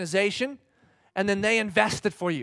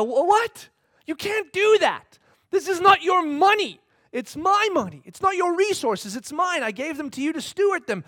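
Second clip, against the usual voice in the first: higher and louder, outraged demand; mock-outraged owner; indignant protest; 8 semitones higher, 7 dB louder than usual. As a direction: insist hard, scandalised it's your money. Owner confronts investor over money.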